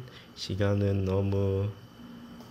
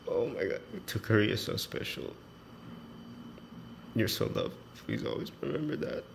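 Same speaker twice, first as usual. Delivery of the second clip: soft, choked-up reading through tears; teary reading; 6 dB quieter than usual. Real, not acted. Reading a classmate's farewell letter while crying softly. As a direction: read it while fighting back tears. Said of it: "the seventh time I'm crying".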